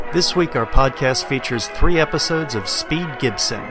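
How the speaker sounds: usual speaking voice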